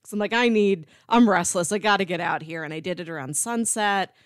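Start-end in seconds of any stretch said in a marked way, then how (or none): none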